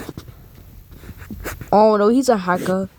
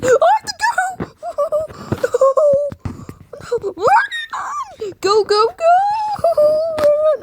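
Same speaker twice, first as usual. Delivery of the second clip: panicked high screaming; scared horror game streamer. Real, not acted. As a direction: shriek while running for your life. Fleeing monster in horror game.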